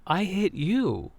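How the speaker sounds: "I hit you" slow and high, leaning on "I"; stress on I. Retold or imitated. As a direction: put the blame on yourself with a high, drawn-out "I". Woman reverses who hit whom.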